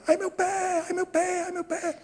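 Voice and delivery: high mock child's cry, fading away; imitated wailing. Boy still yelling in the background, mock wailing. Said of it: "E o menino gritando lá".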